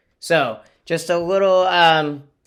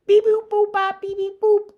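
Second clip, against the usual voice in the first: high beeping robot voice; 5 dB louder than usual. Shown as a demonstration. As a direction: beep it out like a robot. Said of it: "like a robot voice".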